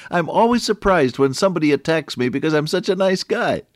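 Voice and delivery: high, loud, amused protest; amused joking. Real, not acted. Preacher joking that he's a nice guy, amused. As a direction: crow it, on the edge of laughter. Jokes about himself between laughs.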